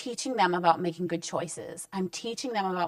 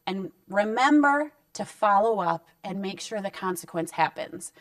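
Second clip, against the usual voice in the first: ordinary talk, nothing marked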